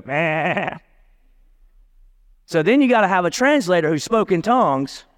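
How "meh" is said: drawn-out "meh" like a goat; mock goat's reply, one long bleat; imitated goat bleat. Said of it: "that goat would go".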